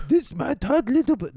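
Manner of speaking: low, badly faked Rasta dentist; attempted Rasta accent. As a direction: have a failed go at a Rasta accent. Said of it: "he wasn't a Rasta".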